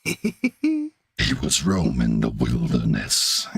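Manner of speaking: very low, slow narrator voice; booming trailer voice; deep voiceover. Pretend. Orson Welles style deep voiceover.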